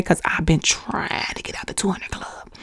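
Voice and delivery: a real whisper under her breath; confiding whisper to the listeners; whispered aside. Real, not acted. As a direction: drop to a secret whisper. Whispered aside about her weight.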